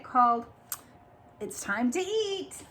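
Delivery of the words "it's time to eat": high chick voice calling; a high little chick call. Acted.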